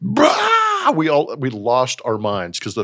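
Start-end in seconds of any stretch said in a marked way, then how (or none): 0.0-1.0 crowd roar. Retold or imitated. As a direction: bellow it like a whole room losing its mind. Whole ballroom erupts at pick.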